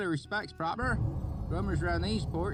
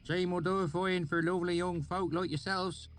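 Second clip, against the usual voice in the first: high rustic country voice; oily backwoods dialect; thick valley accent; 13 semitones higher than usual. A narrator's reading. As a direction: swineherd musing in a thick rural accent. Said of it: "he grinned".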